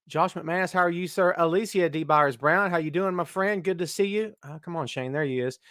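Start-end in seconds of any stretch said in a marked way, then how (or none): none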